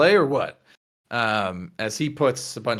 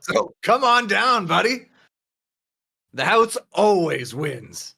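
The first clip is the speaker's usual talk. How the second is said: higher, slower showman's pitch; hammed-up casino host; 6 semitones higher than usual. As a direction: draw it out like a grinning croupier. [name] repeats his casino pitch.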